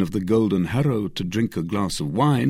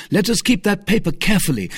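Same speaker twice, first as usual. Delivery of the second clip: quick, high, louder instruction; urgent; 12 semitones higher, 5 dB louder, faster than usual. Acted. D'Artagnan hurrying his friends, quick and urgent. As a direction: rattle it off in haste.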